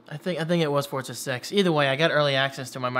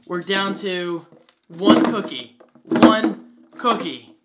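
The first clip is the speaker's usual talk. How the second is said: mock dramatic; 4 semitones higher, 7 dB louder, much slower than usual. Dramatic news to dogs.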